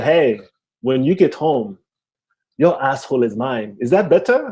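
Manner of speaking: put-on measured threat in a Haitian accent, then a lifted question; mom's thick Haitian accent. Mom threatening her son by phone, Haitian accent. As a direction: imitate a stern Haitian-accented mother. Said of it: "my mom has a very thick accent".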